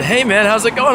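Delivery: cheery greeting. Retold or imitated. Greeting a familiar man on the street, cheery. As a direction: greet an old friend brightly.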